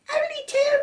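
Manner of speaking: put-on very high baby voice; baby George echoing his sister. A narrator's reading. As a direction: pipe it like a tiny baby. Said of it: "said George".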